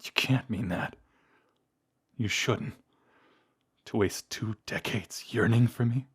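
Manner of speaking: hushed, incredulous questioning; can hardly believe they are still loved; pained disbelief. Acted. Vampire told the lover never got over them, tender disbelief.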